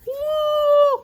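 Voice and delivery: high, loud cheer; a celebratory squeal of joy; excited whoop. Real, not acted. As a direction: let out a happy woo. Cheering while grabbing her knitting.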